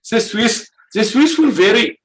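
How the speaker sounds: put-on high, hesitant failed Swiss accent; a lame stab at a Swiss accent; attempted Swiss accent. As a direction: try a Swiss accent and falter. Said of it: "I can't do a Swiss".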